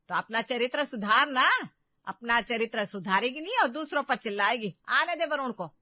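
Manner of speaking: loud, threatening scolding; angry rebuke. Acted. Mother-in-law threatens to tell Varun, angry rebuke.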